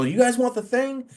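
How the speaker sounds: high hype call to the crowd; wrestling character voice. Retold or imitated. Ref jumps into character to hype the crowd, high showman call. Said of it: "jump right into character".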